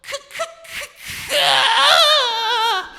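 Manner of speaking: stuttered, high, strained syllable; hammed-up stuck stammer; struggling to speak. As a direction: choke on the first sound, then force it out. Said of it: "So I said".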